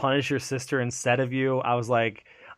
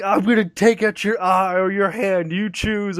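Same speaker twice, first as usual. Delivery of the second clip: raised-pitch retold menace; mock threat; 7 semitones higher, 3 dB louder than usual. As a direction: imitate a leader laying down a threat. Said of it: "threatening you and stuff".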